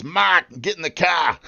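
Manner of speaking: put-on high, broad Massachusetts accent with dropped R's; hammed-up Massachusetts twang. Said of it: "you're probably talking Massachusetts".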